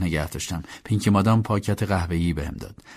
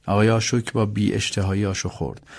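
plain reading voice

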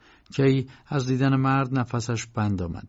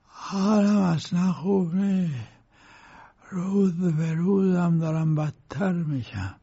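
put-on slow, higher, ailing old man's voice; sick old lawyer; 6 semitones higher, slower than usual. A narrator's reading. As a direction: complain slowly like a bedridden old man. (صدای کند پیرمرد بیمار)

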